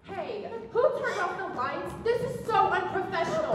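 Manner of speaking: high-pitched indignant whine; indignant complaint. Acted. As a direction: complain loftily, pitched well up. Lights go out, she complains.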